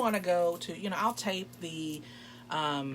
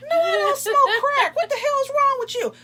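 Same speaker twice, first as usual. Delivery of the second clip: much higher and louder, laughing outrage; indignant yell; 12 dB louder than usual. Retold or imitated. Telling the date off, loud and high with laughter. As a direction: shout it, half laughing, offended. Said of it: "I said".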